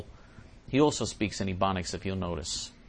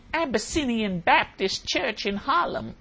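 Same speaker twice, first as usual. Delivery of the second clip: high, slow, drawn-out pronouncement; mock preacher voice; 12 semitones higher, slower than usual. Host names where the speaker would run to, mock preacherly. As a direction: roll out the name like a mock preacher.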